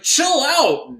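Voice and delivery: loud, high hammed-up order; mock exasperated yell. Doctor trying to settle the panicking woman, loud mock yell. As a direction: shout it high to imitate the doctor. Doctor shaking her, retold.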